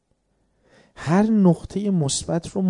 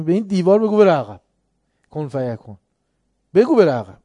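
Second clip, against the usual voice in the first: louder mocking dare, بره hit hard; defiant loud challenge; 3 semitones higher, 8 dB louder than usual. Soldier daring the officer to move the wall, loud and mocking.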